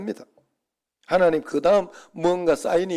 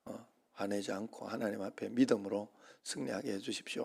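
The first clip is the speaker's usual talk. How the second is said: softer, lower earnest inner prayer; quiet prayer; 5 semitones lower, 9 dB quieter than usual. Retold or imitated. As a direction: pray it inwardly, humble and low. Retold prayer in the car. (조용한 속기도)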